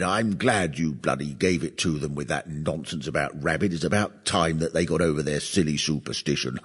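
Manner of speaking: put-on understated old gentleman voice; lightly voiced old gent. Suave eighty-year-old chap quoted.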